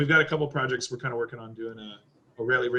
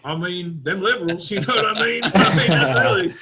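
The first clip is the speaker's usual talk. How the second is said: higher, mock-indignant Texas twang; Texas accent; 3 semitones higher, 5 dB louder than usual. Texan griping about liberals, mock Texas accent. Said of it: "Turn up in Texas".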